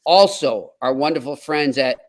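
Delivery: ordinary talk, nothing marked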